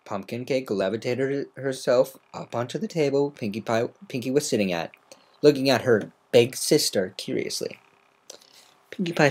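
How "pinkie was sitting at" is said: ordinary delivery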